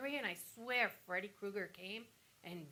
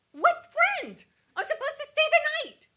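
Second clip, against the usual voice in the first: much higher, much louder cry of disbelief; shocked outcry; 13 dB louder than usual. Retold or imitated. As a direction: shout back in alarm at the nurse. Retold shock at the nurse.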